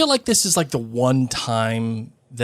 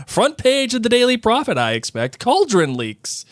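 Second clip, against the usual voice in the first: Ron teasing; 11 semitones higher than usual. Acted. Reading Ron's teasing aloud.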